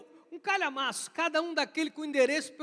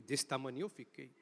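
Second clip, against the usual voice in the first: usual speaking voice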